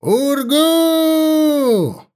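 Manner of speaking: high drawn-out war cry; battle cry. A narrator's reading. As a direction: roar the orc war cry. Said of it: "прокричал боевой клич". (боевой клич орка-шамана)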